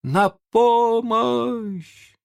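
long-drawn, high-pitched distant cry; faint cry for help. A narrator's reading. Someone in the silent bay begs for rescue, faint drawn-out cry. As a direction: stretch the plea out high and thin. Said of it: "её нарушил слабый крик".

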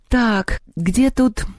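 plain reading voice

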